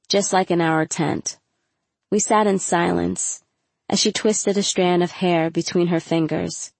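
ordinary delivery, nothing marked

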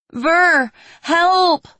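desperate high yell for help; shouting for help. Acted. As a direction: scream it out, calling across the distance. Cry for help from afar.

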